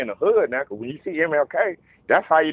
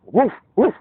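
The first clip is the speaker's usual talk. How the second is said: high, punchy mock dog woof; imitated dog woofs; 8 semitones higher, 6 dB louder than usual. Teller playing the dog nudging for smoke, imitated woofs. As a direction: do the dog's woof, pitched up. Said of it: "doing that soft, like".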